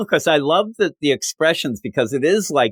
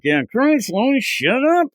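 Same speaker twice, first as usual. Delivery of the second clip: high mocking voice; 12 semitones higher, slower than usual. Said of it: "the guy's like".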